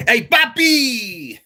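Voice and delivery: high, drawn-out Puerto Rican "Hey, papi!"; playful Puerto Rican accent showing off for chat. Pretend. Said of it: "Hear that Puerto Rican accent".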